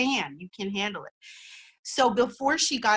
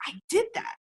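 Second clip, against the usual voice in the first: much higher, rising with delight; proud exclaim; 10 semitones higher, faster than usual. Shown as a demonstration. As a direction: announce it like a surprise win. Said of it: "Look at it and say".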